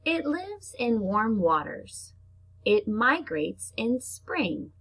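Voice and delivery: slow, drawn-out storybook read; reading to children. Acted. Teacher reading a shark book to her class, slow storybook voice. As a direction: read each short line slowly for little kids. Reads picture book aloud.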